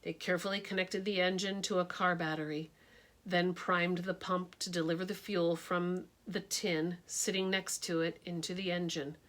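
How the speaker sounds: ordinary delivery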